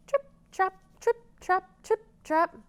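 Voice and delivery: high tripping hooves; little goat trotting across; hoof sounds chant. Shown as a demonstration. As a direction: patter it like small hooves. Goat crosses bridge.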